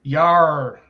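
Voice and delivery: hearty pirate yar. Pretend. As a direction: bark it like a pirate. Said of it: "Sounds like a drunk pirate".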